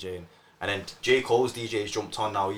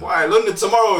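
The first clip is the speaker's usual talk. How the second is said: loud, high incredulous question; retold disbelief; 7 semitones higher, 7 dB louder than usual. Retelling his reply to a last-minute London invitation, disbelieving. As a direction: blurt it back as if taken aback.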